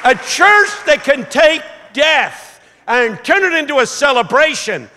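high, loud, exultant sermon shout; full-force preaching. Real, not acted. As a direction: proclaim victory over death to the whole church.

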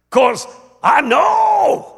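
high, loud, long-drawn final word; preacher's shout. Retold or imitated. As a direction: stretch KNOW into a soaring cry. Imitating the preacher's climax.

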